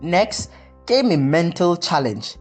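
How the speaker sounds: plain reading voice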